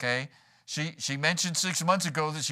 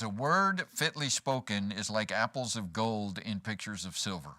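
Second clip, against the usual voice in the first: scripture reading; 4 semitones lower than usual. Acted. Reads scripture.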